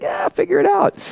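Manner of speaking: imitating his dad waving off his worries, high and airy; mock breezy dad. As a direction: toss it off like it's no big deal. Mocking dad's breezy brush-off.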